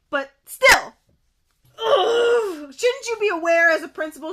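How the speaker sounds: loud, exasperated outburst; groan, then challenge loudly in frustration; real indignation. Real, not acted. Indignant at the principal.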